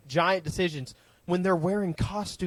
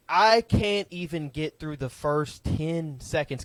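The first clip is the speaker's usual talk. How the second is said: ordinary delivery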